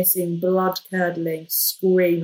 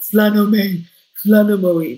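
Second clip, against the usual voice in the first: loud, insistent flannel me; demanding in the heat of labour; mock urgent demand; 3 semitones higher, 8 dB louder, slower than usual. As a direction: bark it out like a woman in labour.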